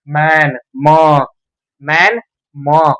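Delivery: मैन, then its first sound म alone, slowly. Shown as a demonstration. Teacher showing which sound starts the word, slowly sounded out. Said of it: "म की sound आ रही है".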